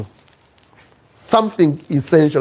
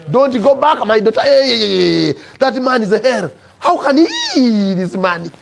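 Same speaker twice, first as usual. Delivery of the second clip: high, wailing indignation; aunt lamenting at a high pitch; acted outraged aunt; 8 semitones higher, 4 dB louder than usual. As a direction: cry out in scandal, stretching the exclamations. Aunt's outraged advice to her niece.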